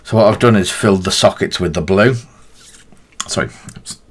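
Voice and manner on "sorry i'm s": talking around a paintbrush; words muffled by brush in mouth; mouth full. Real, not acted. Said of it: "talking with my mouth full of paintbrush".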